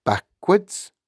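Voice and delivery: a frightened pupil's disbelief; mildly nervous schoolboy query; stammering. A narrator's reading. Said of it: "stammered Wilfred".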